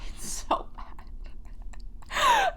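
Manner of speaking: very slow, voiceless squeak through laughter; wheezing laughter. Real, not acted. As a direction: barely get the words out for laughing. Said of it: "I have to laugh because of the shock".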